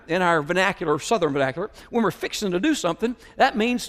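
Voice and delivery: normal talking voice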